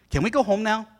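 imitating his son eager to go back; eager kid. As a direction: push to come back like an impatient boy.

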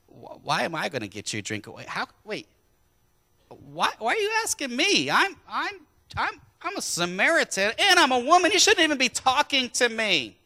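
a preacher's rising, stammering astonished woman. Acted. Preacher voices astonished Samaritan woman.

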